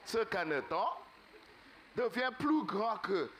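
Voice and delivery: ordinary delivery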